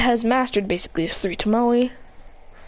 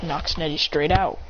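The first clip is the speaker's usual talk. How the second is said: plain reading voice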